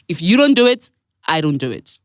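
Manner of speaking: usual speaking voice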